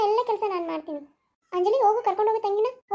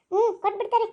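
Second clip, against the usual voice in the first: ordinary delivery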